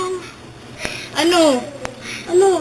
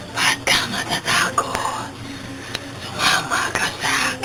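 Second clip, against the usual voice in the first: eerie ghost voice; 5 semitones lower, 6 dB quieter than usual. Acted. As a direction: lure her in like a spirit. Ghost lures the girl away.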